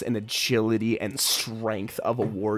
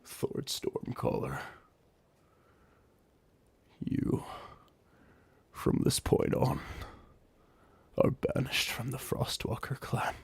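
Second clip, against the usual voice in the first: grave banishment; 6 dB quieter than usual. Acted. Argmith banishing defeated Thord in a hushed solemn voice. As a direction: pronounce the banishment quietly.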